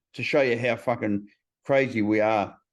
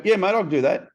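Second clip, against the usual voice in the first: higher, ready yes to a favour; eager agreement; 7 semitones higher, 4 dB louder than usual. Acted. Answering someone who asks a favour, eager agreement. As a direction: agree straight away, pitched up.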